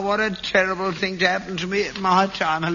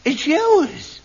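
surprised; 10 semitones higher than usual. Real, not acted. Realizes letter is son's.

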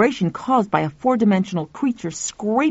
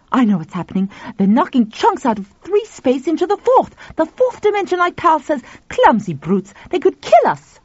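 alarmed; 8 semitones higher, 4 dB louder than usual. Acted. Tuffy alarmed.

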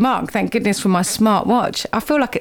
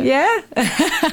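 laughing; 6 semitones higher than usual. Real, not acted. Laughs with guest.

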